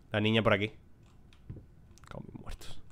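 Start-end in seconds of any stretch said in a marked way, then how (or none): 2.1-2.8 breathy under-the-breath swear; whispered Spanish curse. Real, not acted. Muttered curse while playing.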